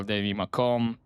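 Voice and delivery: ordinary talk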